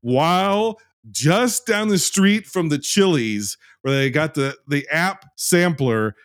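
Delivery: high, drawn-out, emphatic tirade; exasperated rant. Real, not acted. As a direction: stretch the key words out in heated disbelief. Emphatic angry rant about the pandemic.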